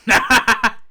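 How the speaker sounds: loud, high burst of laughter; delighted laugh. Real, not acted. Player laughing at the wheel's result, delighted.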